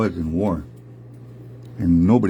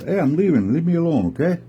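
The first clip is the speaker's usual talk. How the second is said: higher-pitched, put-upon bear; mildly exasperated high bear voice; bear protesting; 7 semitones higher, faster than usual. Acted. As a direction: plead to be left alone, voice lifted. Big bear asks to be left.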